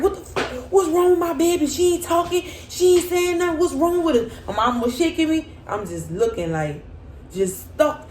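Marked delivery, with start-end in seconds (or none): 0.0-4.4 put-on mother panicking about her baby; panicked mom. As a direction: demand answers in a panic. Mom panicking over silent daughter.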